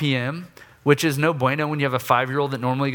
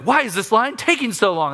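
loud, higher impatient gripe; rude complaint; 8 semitones higher, 4 dB louder than usual. Retold or imitated. Impatient dad in line at the store, loudly griping. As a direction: whine it at the checker, rudely. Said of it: "listen to how rude I am".

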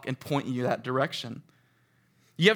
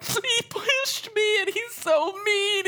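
put-on much higher, slow, whiny little boy; crying kid; much slower than usual. Little boy complaining to his counselor, whiny and high. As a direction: play the upset child tattling. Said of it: "He's crying".

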